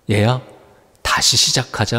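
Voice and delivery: Jesus softly calling Simon back; tender invitation. Retold or imitated. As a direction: speak 다시 시작하자 like a loving father, settling down on 시작하자. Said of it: "말씀하는 거예요".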